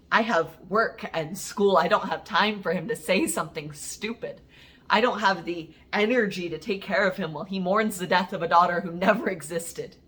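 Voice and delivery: exasperated outburst. Acted. Poet dreading what he will say on coming out, exasperated. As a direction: push it out hard, fed up and hurting. Venting about father's possible reaction.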